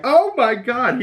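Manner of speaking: high, stunned "oh my god"; sudden shocked outburst; shocked gasp. Retold or imitated. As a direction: react like you just saw a beheading. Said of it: "and I was like".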